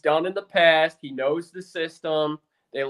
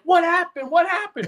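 higher, louder alarmed wife asking twice; 11 semitones higher, 4 dB louder than usual. Retold or imitated. Husband voicing his startled wife woken at night, high and alarmed. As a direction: imitate the startled wife, pitched up. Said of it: "She was like".

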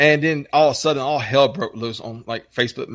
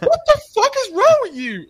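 very high, squealed protest; hammed-up hysterical disbelief; mock outrage; 4 dB louder than usual. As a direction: imitate someone losing it completely. Acts out exasperated reply in quarrel.

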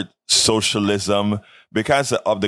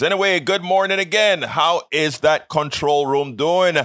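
bright greeting; 7 semitones higher than usual. Real, not acted.

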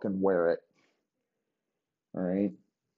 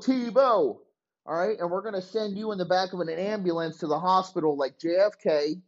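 higher, eager coach laying out a crazy plan; a lightly raised, wheeling-dealing coach; scheming coach voice; 8 semitones higher than usual. Retold or imitated. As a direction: sell the scheme like a hyped head coach. Voicing Urban Meyer pitching plans.

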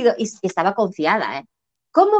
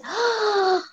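long high drawn-out "¡ah!" of giving up; exasperated sigh; 10 semitones higher, 5 dB quieter than usual. Retold or imitated. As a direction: let out a slow exasperated cry. Exasperated breaking point retold. (suspiro largo y agudo de rendición)

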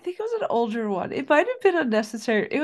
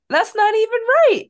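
higher, louder mock protest; retold indignation; 10 semitones higher, 5 dB louder than usual. Cousin objecting to the wrong ethnic slur, retold indignation. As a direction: imitate an offended objection. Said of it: "got mad and got in a fight".

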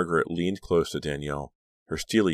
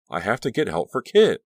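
higher, louder desperate plea; urgent insistence; 6 semitones higher, 7 dB louder than usual. A narrator's reading. Girl insists on getting help.

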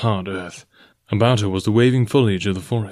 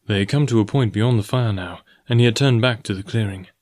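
plain reading voice

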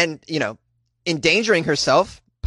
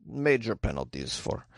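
Ukrainian accent; 2 semitones lower than usual. Shown as a demonstration. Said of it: "I should do a Ukrainian accent for this".

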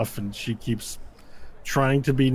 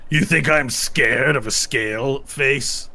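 taunting orc; 2 semitones higher, 4 dB louder, slower than usual. Acted. Orc taunts player character.

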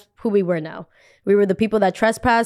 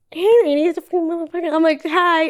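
mock greeting; 9 semitones higher, slower than usual. Mocking the director's greeting back.

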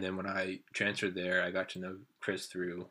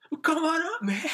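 high, loud holler; calling out; 10 dB louder than usual. Retold or imitated. Friends invite drunk strangers up, loud retold holler. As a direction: shout them over, imitating your friends.

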